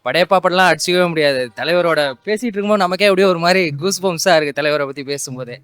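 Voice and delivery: normal talking voice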